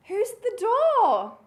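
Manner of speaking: loud, high, wondering question; gasp, then ask with big curiosity; playful astonishment for children; pretend surprise.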